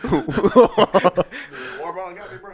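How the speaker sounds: high, drawn-out words through laughter; real laughing fit, slow and high. Real, not acted. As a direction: squeeze it out slowly while cracking up. Laughing after choking on his water.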